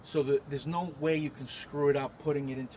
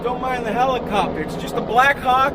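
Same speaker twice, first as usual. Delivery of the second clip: loud, raised voice over rotor noise; yelling over helicopter; 5 semitones higher, 7 dB louder than usual. Real, not acted. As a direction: shout over a helicopter flying past. Helicopter overhead, he talks over it.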